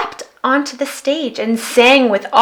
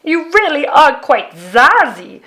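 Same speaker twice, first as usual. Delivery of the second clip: loud, beaming monster voice; grateful Yeti; 5 semitones higher, 5 dB louder than usual. A narrator's reading. Yeti thanks the girl for his new bass, loud and grateful. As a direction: thank her like a delighted giant. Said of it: "said the Yeti gratefully".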